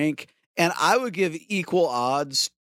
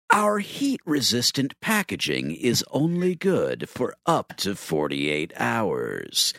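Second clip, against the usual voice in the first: much lower, slow ominous read; Numerology Jed voice; 7 semitones lower, slower than usual. Pretend. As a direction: draw out the doom. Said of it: "in the Numerology Jed voice".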